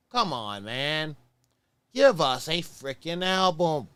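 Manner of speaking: loud, slow, pleading demand; exasperated plea. Real, not acted. Begging for new album.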